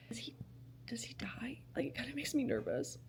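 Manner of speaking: nervous whisper; hushed and worried. Real, not acted. Worried about character dying.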